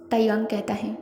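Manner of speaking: ordinary delivery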